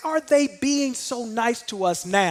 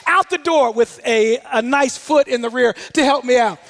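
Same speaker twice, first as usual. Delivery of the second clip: ordinary talk, nothing marked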